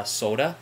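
"soda" in her own Midwestern vowels; "soda" with her Upper Midwest o. Shown as a demonstration. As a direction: say "soda" the way she says it at home. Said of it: "I say soda funny".

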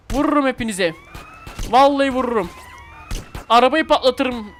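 loud mock threat, slowing down; hammed-up threats. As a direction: bark the threats like a thug.

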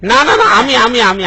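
high, loud stammered objection; flustered protest. Acted. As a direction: object in a hurry, tripping over repeats. Flustered protest at being scolded. (ঘাবড়ে গিয়ে জোরে আপত্তি)

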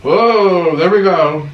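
loud thrilled exclamation; excited whoa. Real, not acted. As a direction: burst out at the heat. Reacting to nuclear spicy wing.

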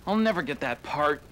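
ordinary talk

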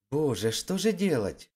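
plain reading voice

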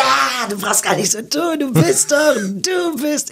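high, excited stammering fan; mock excited fan, pitched high; eager fan. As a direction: play the fan who can't recall the name. Imitating the insistent fan.

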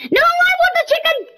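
very high, loud and fast; fast high shout; 1 excited shout. Real, not acted. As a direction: yell it out grabbing. Grabbing food.

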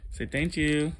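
high sing-song prompt. Real, not acted. Parent teaching toddler manners, coaxing. Coaching a child to say thanks.

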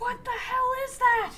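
high, loud terrified shouting; scared horror game streamer. Real, not acted. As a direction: yell in panic at the monster. Another creature appears.

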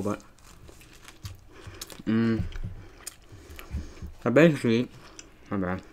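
speaking between bites of burger; talking while chewing. Real, not acted. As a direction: mouth partly full of food. Seen 0: Bites burger at -4, cheeks still working early; later looks cleared.

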